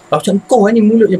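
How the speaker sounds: high, loud accusation; heated mock telling-off; retold angry blame. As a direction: act out snapping at a sister. Retold blaming of sister.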